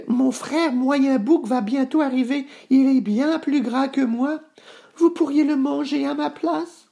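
very high-pitched goat voice; small goat begging, way up high; little billy goat, pleading. Acted. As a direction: bargain high as a frightened kid goat. Petit Bouc pleads with troll.

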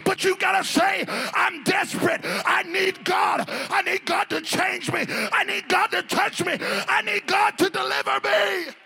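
high, loud preaching shout; preaching at full force. Acted. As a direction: press the church with it.

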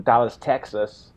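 a light, slowed Texan twang on Dallas, Texas; slipped into a Texas accent. Real, not acted. Podcast guest naming the Texas team, in a slight Texas accent. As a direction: drift into a hint of a Texas accent. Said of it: "like, different accent for Texas".

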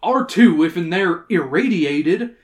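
put-on loud, smug old-timer. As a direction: insist smugly, like you know better. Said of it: "Sam said smugly".

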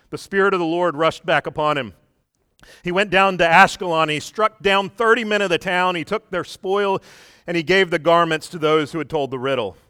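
scripture reading. Acted. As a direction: proclaim the verses with force. Reads scripture aloud.

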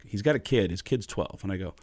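normal talking voice

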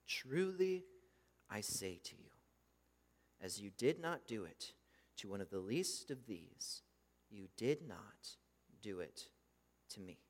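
hushed sermon gravity; solemn pronouncement. Acted. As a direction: let the judgment fall quietly. Solemn reading of judgment.

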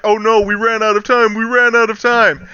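high, imitated panic; mock freak-out. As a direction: retell it as a mild panicked wail. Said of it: "they start freaking out".

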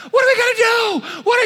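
high, loud panicked yell. Retold or imitated. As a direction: shout it in a frenzy. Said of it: "He's yelling at me".

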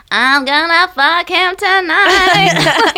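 slow, high sing-song tease; goofy sing-song. Retold or imitated. Imitating friend's goofy side in high sing-song. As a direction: sing it out like a giddy goof. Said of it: "the other side of you".

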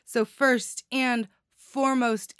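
usual speaking voice

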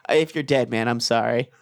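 subdued retold apology; mock resignation. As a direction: drop into a resigned mock farewell. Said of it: "all the stages of grief".

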